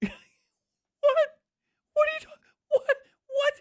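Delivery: very high squeaky stammer; shocked disbelief. Acted. Kicker told he can't kick, high stunned sputtering. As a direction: sputter helplessly in a falsetto of shock. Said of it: "The shock is crazy".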